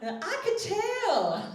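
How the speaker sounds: high, loud, slow sing-song through laughter; laughing old lady. Retold or imitated. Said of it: "And I'm like".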